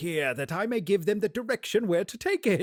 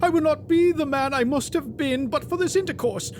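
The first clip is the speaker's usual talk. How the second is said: raised, urgent plea, higher than the narration; pleading Scrooge; 8 semitones higher, 4 dB louder than usual. Acted. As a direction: beg to be heard, pressing harder each line.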